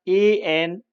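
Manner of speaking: letters read out one by one; deliberate spelling for students; spelling out the word for the class; slow spelling. Shown as a demonstration. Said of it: "वैसे anaemia".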